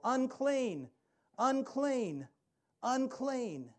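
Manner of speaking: high, loud cry of unclean; yelling unclean. Retold or imitated. Outcast woman warning the crowd, loud high cry. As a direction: call out a warning to the room. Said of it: "she would have to yell".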